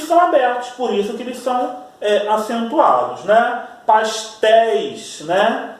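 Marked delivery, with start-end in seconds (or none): 3.9-5.2 "Pastéis" said very slowly, the éi opened wide and held; open éi stretched. Shown as a demonstration. Teacher to students, showing how the sound opens in "Pastéis". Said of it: "como o som abre".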